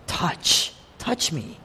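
near-whispered, very soft "touch, touch me"; a hushed offer to be touched; hushed invitation. Retold or imitated. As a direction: breathe it gently, almost unvoiced. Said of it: "what does he say to Thomas?".

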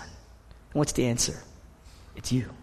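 ordinary talk, nothing marked

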